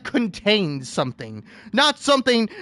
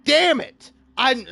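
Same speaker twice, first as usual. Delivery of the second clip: ordinary delivery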